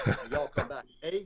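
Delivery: a quick joking Texan "y'all come back"; soft, throwaway Texan accent; mock Texan drawl. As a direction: toss it off in a Texan accent, half-laughing. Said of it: "you can tell by my accent, I'm a Texan".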